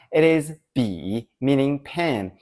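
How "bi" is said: "bi" on a low dipping tone; "bi" in Mandarin third tone. Shown as a demonstration. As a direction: dip the pitch down on "bi". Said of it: "With the third tone".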